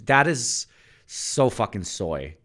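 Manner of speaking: drawn-out teasing jab; mocking drawl. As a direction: stretch "That is so fucking soy" out in a sneering tone. Mocking the voice change.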